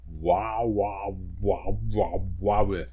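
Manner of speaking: sounding out "Wow" over and over; trying out pronunciation. Real, not acted. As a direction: feel out "wow" as a tricky name's first syllable. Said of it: "I'm having problems with this one".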